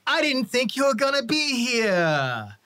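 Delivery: high, loud, drawn-out hail; acted over-the-top welcome; hearty surprised greeting. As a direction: call out the name long and high. George greets elf boss, acted.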